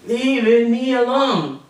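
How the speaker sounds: slow, loud, high "Leave me alone"; loud deliberate learner's repeat; loud careful copy. Retold or imitated. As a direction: say it loud and slow, copying the teacher. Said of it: "he said".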